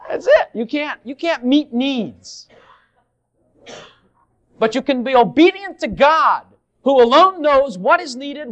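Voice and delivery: high, loud, slow pulpit cry; preacher at full force proclaiming obedience to God; preaching at full force. Acted. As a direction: thunder it from the pulpit. Preaching peak.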